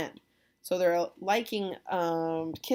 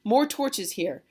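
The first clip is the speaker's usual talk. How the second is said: loud theatrical surprise turning to thanks; hammed-up host's farewell, high and projected; hearty host Capulet; 4 semitones higher, 7 dB louder than usual. Capulet thanking departing guests, loud acted farewell.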